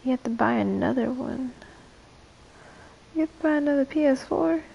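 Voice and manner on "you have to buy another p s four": higher, quicker disbelieving question; incredulous. Real, not acted. Streamer reacting to a viewer's comment, incredulous. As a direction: echo it back in disbelief, pitched up.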